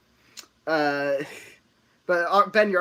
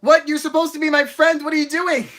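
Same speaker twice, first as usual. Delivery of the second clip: high, loud playful accusation; mock outrage; 13 semitones higher, 11 dB louder than usual. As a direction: yell it like a betrayed friend. Mock betrayal at friend.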